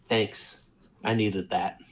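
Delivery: soft thanks. Acted. Raz thanking Torn softly.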